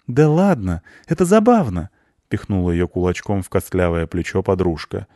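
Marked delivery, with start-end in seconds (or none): 0.1-1.9 put-on high, bright-pitched girlish teasing; playful coaxing. A narrator's reading. Friend nudging the grumpy cleaner, playful coaxing.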